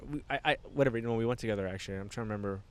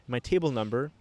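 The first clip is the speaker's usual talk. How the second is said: usual speaking voice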